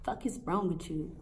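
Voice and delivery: usual speaking voice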